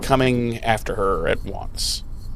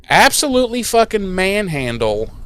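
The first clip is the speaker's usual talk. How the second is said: ordinary talk, nothing marked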